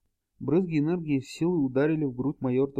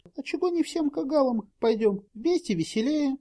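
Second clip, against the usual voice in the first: put-on high, light girlish voice; bright young woman asking; 11 semitones higher than usual. A narrator's reading. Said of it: "спросила Кролина".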